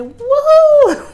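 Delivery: high, loud laughing woohoo; excited whoop. Real, not acted. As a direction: whoop with delight. Playful exclamation.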